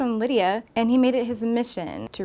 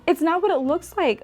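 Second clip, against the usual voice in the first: panicked protest; 8 semitones higher, 4 dB louder than usual. Acted. Caught in the act, protesting.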